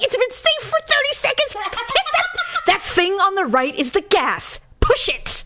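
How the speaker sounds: hammering the point at a slow driver; exasperated nagging. Retold or imitated. Wife retelling nagging her husband at the wheel, high exasperated nag. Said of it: "I'm like".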